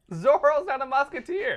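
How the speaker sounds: laughing loudly, pitched high. Real, not acted. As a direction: blurt it out through big laughter. Laughing after the Zorro joke.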